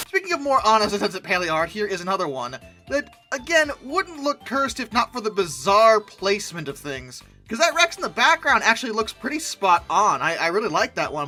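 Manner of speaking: ordinary talk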